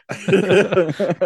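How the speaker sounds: high burst of laughter; loud laugh. Real, not acted. Laugh at gross bloodstains.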